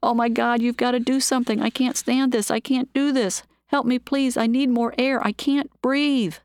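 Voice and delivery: panicked shout. Acted. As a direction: beg for help, fighting for air. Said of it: "He shouted".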